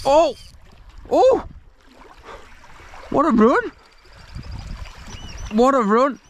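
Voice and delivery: sudden high thrilled cries; excited shouts. Real, not acted. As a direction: yelp with delight as the fish runs. Big fish suddenly takes line.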